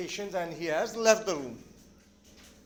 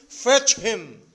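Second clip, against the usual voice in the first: loud acted wail, hardly tearful; 9 dB louder than usual. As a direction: demand it in a mock wail. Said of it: "She starts crying and says".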